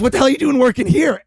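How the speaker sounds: incredulous outburst. Retold or imitated. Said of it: "He goes".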